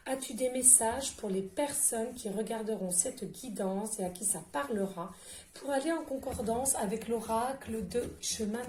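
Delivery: hushed, pleading prayer to the oracle; soft invocation to the cards. Acted. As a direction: coax the deck gently, half-whispered. Invoking the oracle deck.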